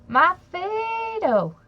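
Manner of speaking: mock yell. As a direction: stretch the name out like calling for him. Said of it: "his hussy kept yelling".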